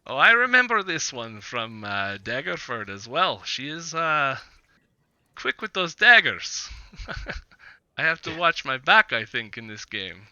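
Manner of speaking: playfully wary of a dagger thrower; Vistani tavern acquaintance. Acted. Game master voices Vistani man.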